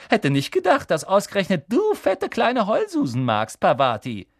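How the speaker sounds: high, loud mocking schoolgirl; sneering Pansy. Pansy mocking Parvati, sneering and high. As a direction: jeer at her like a spiteful girl.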